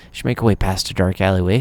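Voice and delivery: normal talking voice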